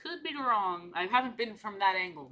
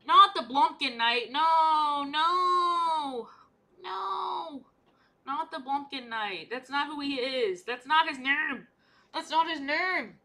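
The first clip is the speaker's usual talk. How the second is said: high, drawn-out pleading no's; mock wailing protest; 5 semitones higher, 4 dB louder, much slower than usual. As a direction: wail it in exaggerated dismay. Mock protest over mangled name.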